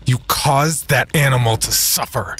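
high, squeaky, angry shout. Acted. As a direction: squeak it out in anger. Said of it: "squeaked in his high, angry voice".

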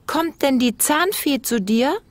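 put-on curious little boy. A narrator's reading. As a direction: ask wide-eyed like a five-year-old. Felix fragt Oma. (neugierige Kinderfrage)

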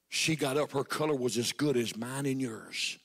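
ordinary talk, nothing marked

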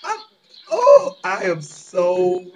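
loud, slow, choking on the anger; fuming exasperation. Real, not acted. Telling viewers about abusers, loud slow fury. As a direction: boil over, can't get the words out. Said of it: "I am really mad about this".

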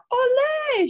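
high, loud crowd shout; shouted cheer. A narrator's reading. Crowd cheering the circus act, shouting. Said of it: "shouted the crowd".

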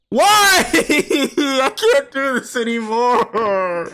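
high, drawn-out whiny crying wail; despairing wail. Real, not acted. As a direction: wail it slowly, half laughing, half crying. Wailing after a scare.

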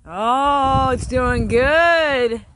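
high, loud and drawn out with relief; relieved delight. Real, not acted. Beekeeper finds his hive doing well, relieved joy. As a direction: let the relief burst out, slowly.

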